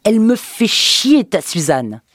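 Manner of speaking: wife yelling at husband about Suzanne, trembling fury; trembling furious yell. A narrator's reading. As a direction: scream it out, helpless fury trembling in the voice. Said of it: "se mit à trembler quand elle hurla".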